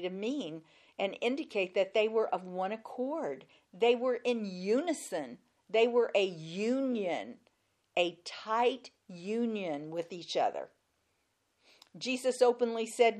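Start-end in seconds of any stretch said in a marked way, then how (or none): none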